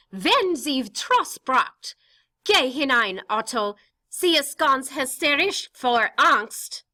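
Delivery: wounded mother, high and slow; mother pushed away by her daughter; hurt mother. Acted. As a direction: hide the hurt, beg him to go. Mrs Frank hurt, pleading in German.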